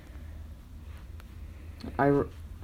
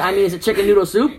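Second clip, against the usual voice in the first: laughing quote; 4 semitones higher, 5 dB louder than usual. Retold or imitated. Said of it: "he said".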